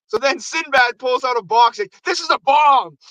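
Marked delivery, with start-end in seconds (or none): 2.1-3.0 higher-pitched threatening announcement; mock threat. Mailman holding up a box to the crowd, mock threatening. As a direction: imitate a wild-eyed bluffer.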